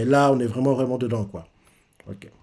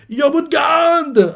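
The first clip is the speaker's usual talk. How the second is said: a much higher, slowed shout of the attack name; 13 semitones higher, 4 dB louder, slower than usual. Retold or imitated. The sun god screaming his attack, slow high shout.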